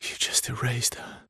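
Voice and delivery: soft, breathy whisper of blame; hushed accusation. Acted. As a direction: whisper it like a wound. Grieving accusation after sister's loss.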